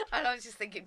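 ordinary delivery

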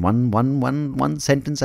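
normal talking voice

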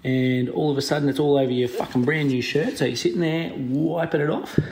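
ordinary talk, nothing marked

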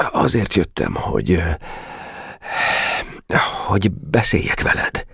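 halting, with long pauses; hesitant. A narrator's reading. Geréb facing Boka after the silence, hesitant. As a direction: grope for how to begin.

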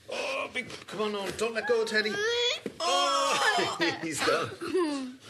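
high laughing cheer; excited. Real, not acted. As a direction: cheer the dog on, delighted.

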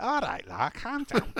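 hammed-up Scouse, jumping high at the end; exaggerated Liverpudlian accent, pitch leaping; mock Scouse accent. Showing what the podcasters don't sound like, mock Scouse accent. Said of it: "they're not like".

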